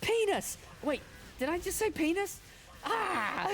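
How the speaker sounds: high, loud ranting comic impression; Orny Adams rant. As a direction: yell it like a fed-up comic on stage. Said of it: "He's like".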